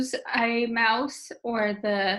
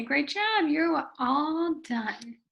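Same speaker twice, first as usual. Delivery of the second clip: higher, drawn-out praise; praising a student; 4 semitones higher, much slower than usual. Shown as a demonstration. Teacher telling a student they are finished, praising. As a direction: praise them, stretching out the last words. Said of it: "And then you can say".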